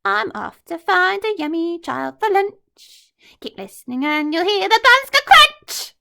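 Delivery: gloating rhyme. Acted. Crocodile gloating to Trunky, wicked and gleeful. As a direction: relish the crunch.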